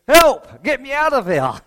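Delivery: high, loud mock cry for help; hammed-up plea to be rescued; mock panicked cry. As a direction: yelp it like a panicking criminal. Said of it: "number one says".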